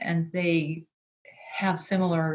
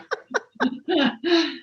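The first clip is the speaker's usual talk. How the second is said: high burst of real laughter; laughing; 10 semitones higher than usual. Real, not acted. Laughing at admitting she's an ugly crier. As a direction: let out a high giggle.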